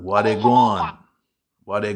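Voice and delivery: slow, loud Jamaican greeting; Jamaican accent. Shown as a demonstration. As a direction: drag it out in Jamaican style. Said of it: "Or can you do Jamaican?".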